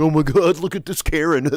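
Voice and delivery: mock-shocked onlooker; mocking phone filmer. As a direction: gawk at her like a bystander filming. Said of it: "pulling our phones out and going".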